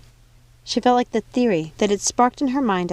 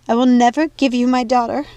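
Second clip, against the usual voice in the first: loud, higher defiant refusal; defiant shout; 5 semitones higher, 7 dB louder than usual. Acted. Daughter refusing the witch, loud defiant cry. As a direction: throw the refusal back at her. Mania refuses her mother.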